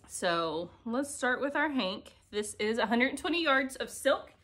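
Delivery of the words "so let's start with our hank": normal talking voice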